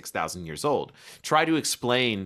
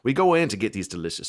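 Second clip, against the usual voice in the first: ordinary talk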